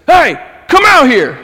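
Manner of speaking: loud, high-pitched shout into the distance; acted-out challenge at full voice; yelling. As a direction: holler to flush someone out. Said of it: "So he goes".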